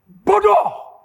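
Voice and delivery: high, loud sudden shout; strong voice. Retold or imitated. Preacher voices the inner voice stopping him, strong high shout. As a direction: shout it out as one sharp blast. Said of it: "ada suara roh suci kuat sekali".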